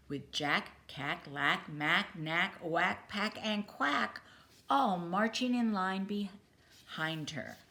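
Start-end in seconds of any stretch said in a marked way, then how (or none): none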